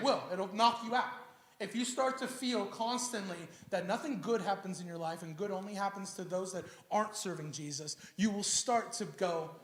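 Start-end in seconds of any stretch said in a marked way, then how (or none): none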